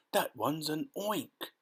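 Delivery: high, drawn-out cry of alarm; storybook scream without full volume; mock scream. A narrator's reading. Fire chief sees the grasshopper, high mock scream. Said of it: "screamed the head of the fire department".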